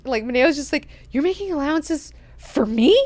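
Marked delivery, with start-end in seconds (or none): 1.1-3.0 high, indignant echo rising at the end; incredulous question. Retold or imitated. Maneo answering Duncan's allowance, incredulous indignation. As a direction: throw it back in disbelief.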